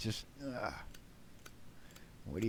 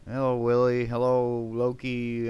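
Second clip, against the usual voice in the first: louder, higher hello to viewers; loud greeting; 3 semitones higher, 6 dB louder than usual. Real, not acted. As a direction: call out each name warmly. Greeting chat loudly.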